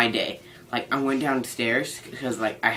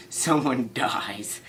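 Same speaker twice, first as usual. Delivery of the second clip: whispered mock death threat, slow; menacing whisper; much slower than usual. Over missing quesadillas, to the camera, a slow menacing whisper. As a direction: hiss the threat like a villain.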